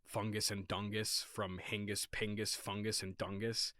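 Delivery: ordinary delivery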